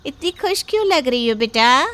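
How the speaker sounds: plain reading voice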